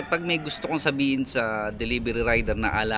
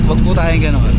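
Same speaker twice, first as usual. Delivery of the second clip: louder, pleading; loud plea; 2 semitones higher, 10 dB louder than usual. Real, not acted. Rider pleading loudly with parents to stop yelling at kids. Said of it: "repeats plea loudly".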